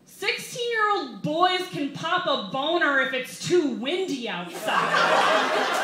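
loud, high comic outrage, slowing at the end; indignant rant. Real, not acted. Comic ranting to the crowd about girls' thoughts, loud and high. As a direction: build the rant with a laugh.

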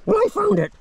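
high silly bragging dog voice; voicing the dog. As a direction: boast like the dog who won the fight. Dog voice in mock argument.

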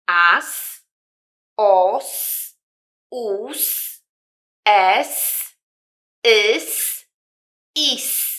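"As, os, us, es, ys, is" one by one, each ending in a long s; "As, os, us, es, ys, is" as isolated drill syllables with sustained s endings; syllable demo. Shown as a demonstration. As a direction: say each syllable separately, holding the final "s". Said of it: "każdy kawałek podpisujemy daną sylabą".

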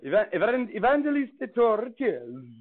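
playful Italian accent on the name; hammed-up Italian accent. Shown as a demonstration. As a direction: say Evangeliste Torricelli like an Italian waiter.

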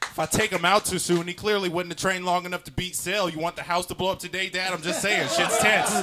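high, loud, worked-up warning; urgent kid pleading. Acted. Kid warning dad about the action figure's training, high, loud and urgent. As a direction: argue it like the house is at stake.

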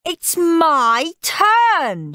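high, drawn-out yell; loud, slow, high insistence; shouted protest. A narrator's reading. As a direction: stretch it into a bratty shout. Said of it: "shouted Henry".

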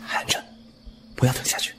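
soft, breathy hush to the patient; hushed warning. Acted. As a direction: keep it barely above a breath. 低声叮嘱含着药. (压低声音轻声叮嘱)

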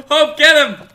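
laughing, urging on; laughing cheer. Real, not acted. Cheering again, reordered.

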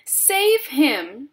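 high, loud panicked cry; narrated scream. A narrator's reading. Missus Gloop seeing her son sink, screaming. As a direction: scream for help, slightly restrained. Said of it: "screamed Missus Gloop".

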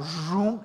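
zooming sound of a sliding drawer; vocal whoosh for a bank drawer; imitated drawer noise. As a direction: mimic a drawer shooting out with a quick zoom. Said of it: "opens the little drawer that comes out".

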